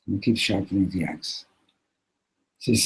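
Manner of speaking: ordinary delivery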